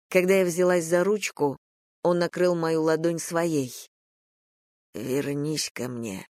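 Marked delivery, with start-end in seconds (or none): 4.9-6.3 low, slow, hushed murmur; softly and slowly, voice dropped low, as if whispering. A narrator's reading. Man to the woman leaving him, softly whispered plea. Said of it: "прошептал он".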